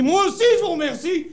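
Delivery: high, forceful acted comeback; indignant retort. Director firing the guide, indignant high retort. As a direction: throw the thanks back high and hard.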